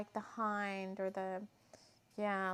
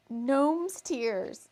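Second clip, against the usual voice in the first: incredulous repeat; 4 semitones higher, 9 dB louder than usual. Real, not acted. Repeating odd ingredient in disbelief.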